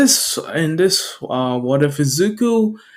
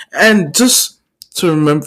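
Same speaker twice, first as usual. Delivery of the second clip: usual speaking voice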